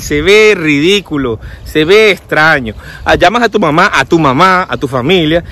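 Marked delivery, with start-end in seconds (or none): none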